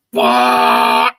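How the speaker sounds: a loud, sudden burst of sound imitating a violent purge; mock explosion noise. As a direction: spit out a loud mock blast. Mimicking explosive toilet moment.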